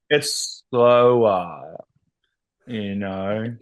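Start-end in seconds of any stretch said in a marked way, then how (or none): 0.1-1.8 "slower" very slowly in an Australian drawl; Australian accent. Shown as a demonstration. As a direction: stretch "it's slower" out like an Australian. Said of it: "That's pretty good".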